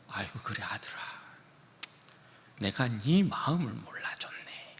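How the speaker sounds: soft, hushed consolation to a hurt son; a father softly soothing his son; tender father. Pretend. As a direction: breathe the words gently, almost whispering.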